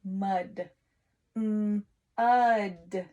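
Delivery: mud, then mm and ud split apart; sounding out mud. Shown as a demonstration. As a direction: break mud into its sounds to check it. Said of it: "check to make sure you spelled it right".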